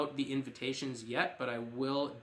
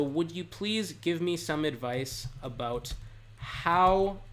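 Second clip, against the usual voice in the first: slow dictation; slower than usual. Shown as a demonstration. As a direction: spell out the phrase chunk by chunk for learners. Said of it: "an advanced phrase here that you could use".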